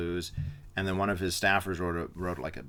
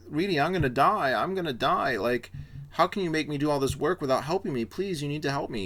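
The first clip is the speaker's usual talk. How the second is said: higher, pleading mock moan; whiny begging LBJ; 7 semitones higher than usual. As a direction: play the needy sick boss begging for help. LBJ begging Reedy after heart attack.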